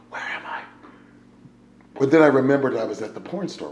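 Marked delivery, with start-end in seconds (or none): 0.1-0.6 whisper. Retold or imitated. Waking up confused in dark.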